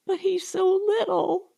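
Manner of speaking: smothered sob. A narrator's reading. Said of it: "sobbed Molly in a smothered voice".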